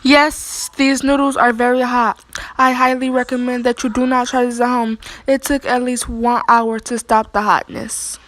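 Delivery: loud, emphatic camera address; loud announcement. Real, not acted. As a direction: announce it to the viewers loudly.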